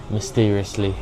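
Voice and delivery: ordinary delivery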